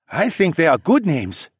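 friendly, eager male voice; helpful. A narrator's reading. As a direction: offer it warmly. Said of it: "said helpfully".

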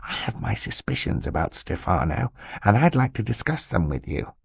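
soft, hushed uncle voice; confidential whisper. A narrator's reading. Uncle confides suspicions about his new assistant, hushed. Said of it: "leaning in to whisper to the children".

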